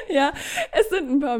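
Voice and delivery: talking through laughter; laughing. Real, not acted. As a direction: laugh while you speak. Still laughing after joke.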